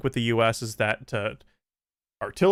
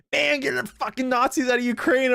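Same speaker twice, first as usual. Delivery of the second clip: high, mock-outraged voice; whiny caricature of Twitter users; mocking quote; 11 semitones higher than usual. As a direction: parody a naive commenter. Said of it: "it's like people like".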